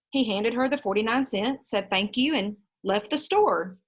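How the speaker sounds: usual speaking voice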